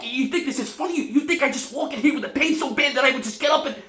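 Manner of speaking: frantic, high and loud with gasping dips; comic meltdown of a man sure he's dying; hysterical hypochondriac. Acted. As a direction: rant in mounting panic to an outraged shout. Performs Laughter on 23rd Floor monologue.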